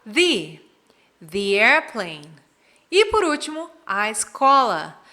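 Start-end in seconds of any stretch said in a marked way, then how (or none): 0.0-0.6 "the" with a long ee vowel; "the" as thee. Shown as a demonstration. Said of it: "como tem som de vogal". 1.2-2.3 "the airplane" slowly, as "thee airplane"; long ee in "the" before "airplane". Shown as a demonstration. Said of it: "como tem som de vogal".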